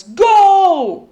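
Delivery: huge, high, loud start shout; roaring coach. Acted. Coach roars the start of the race. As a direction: blast the word to start the race. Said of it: "roared the coach".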